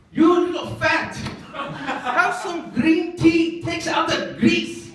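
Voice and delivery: loud, high, drawn-out scolding; hammed-up shout. Restaurant owner scolds a sweating customer, loud hammed-up shout. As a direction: stretch the insult out slowly at high volume.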